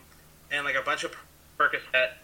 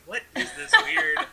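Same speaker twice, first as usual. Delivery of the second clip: baffled; 11 semitones higher, 6 dB louder than usual. Retold or imitated. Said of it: "And I'm like".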